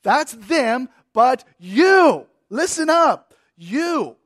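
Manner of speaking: high, loud commanding You; preacher barking you, listen up to the flock; emphatic call. Shown as a demonstration. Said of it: "you" is emphatic".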